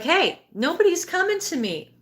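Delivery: high-pitched mock protest of a baby; crying child. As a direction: act out an ignored baby calling out, no real tears. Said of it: "you're crying and you're like".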